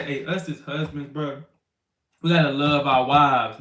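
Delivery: usual speaking voice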